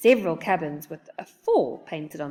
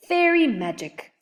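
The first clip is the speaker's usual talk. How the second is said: grunted; 8 semitones higher, 4 dB louder than usual. A narrator's reading. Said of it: "Grumplestick grunted".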